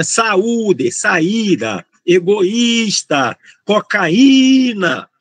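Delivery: "saúde, saída, egoísta, cocaína" slowly, stressed vowel drawn out; stressed accented vowel. Shown as a demonstration. Teacher listing accented hiatus words, stressed vowels held long. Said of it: "Tudo acentuado por esse motivo".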